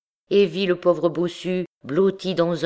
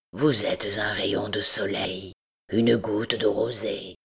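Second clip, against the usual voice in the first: low, slow, whispered lament; Quasimodo, sorrowful; 6 semitones lower than usual. A narrator's reading. Hunchback comparing himself to the girl, low whispered lament.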